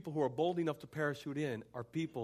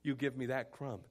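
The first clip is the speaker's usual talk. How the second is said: soft, lowered, assured plea; quiet faith; 3 semitones lower, 6 dB quieter than usual. Acted. Woman trusting Jesus for a crumb, soft assurance. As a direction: speak the faith gently, sentence by sentence. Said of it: "notice her faith".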